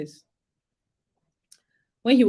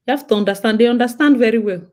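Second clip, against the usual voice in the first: normal talking voice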